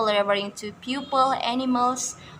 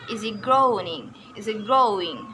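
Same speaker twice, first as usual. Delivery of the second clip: easy growing with GROWING stressed; easy growing, weight on the second word. Shown as a demonstration. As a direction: hit GROW in each easy growing. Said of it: "stress is put on the second word".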